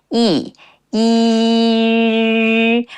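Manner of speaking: 一 held as "ee", second one gliding to rounded "yu"; isolated "ee" vowel. Shown as a demonstration. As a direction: sustain 一 and keep the tongue still. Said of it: "the tone position of 一".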